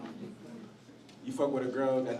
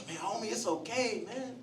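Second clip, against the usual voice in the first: consoling homie; 8 semitones higher than usual. Retold or imitated. Uber driver comforting his tearful passenger, gently soothing. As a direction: imitate a tender ex-gangster soothing a crier. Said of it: "He's like".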